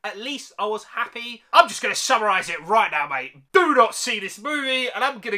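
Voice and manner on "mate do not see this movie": ordinary delivery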